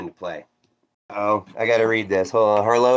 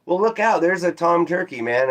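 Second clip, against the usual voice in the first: alarmed warning; 7 semitones higher than usual. Retold or imitated. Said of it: "I was like".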